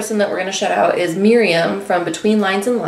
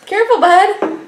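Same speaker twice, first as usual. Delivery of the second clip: talking to a pet; 11 semitones higher, 4 dB louder than usual. Real, not acted.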